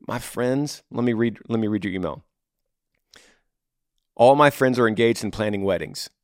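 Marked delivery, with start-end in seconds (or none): none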